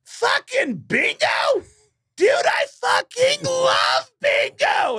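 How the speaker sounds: very high, slow screechy shout; hammed-up ecstatic squeal, words stretched out. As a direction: imitate a rocker losing it with childlike glee. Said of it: "And he goes".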